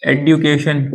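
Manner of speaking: "एडुकेशन" as ed-yoo-kay-shun; other country's variant. Shown as a demonstration. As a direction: hit the hard d in "एडुकेशन". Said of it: "एक देश बोल रहा है". ("एडुकेशन" में साफ़ ड्यू)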